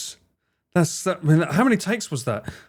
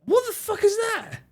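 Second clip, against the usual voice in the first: much higher, baffled cry; shocked disbelief. Real, not acted. Streamer baffled by a strange ad, high shocked disbelief. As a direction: recoil at the weird ad.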